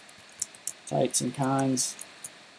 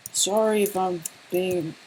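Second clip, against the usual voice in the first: higher, slower sorry if I'm being; mock apologetic; 5 semitones higher, slower than usual. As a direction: ham up the apology to the chat. Restarts the apology in a put-on voice.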